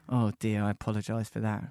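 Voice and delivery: low, soft, rueful sorry; subdued apology. Real, not acted. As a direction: drop the voice and apologise quietly. Apologising for past gig.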